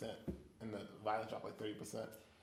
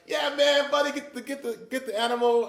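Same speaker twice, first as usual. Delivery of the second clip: mock hunter call; 8 semitones higher, 4 dB louder than usual. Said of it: "we're like".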